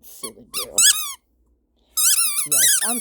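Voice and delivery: squeaky high pet voice. Real, not acted. Scolding her dog gently, very high baby talk. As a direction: coo at the dog in a tiny voice.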